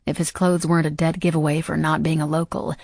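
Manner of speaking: plain reading voice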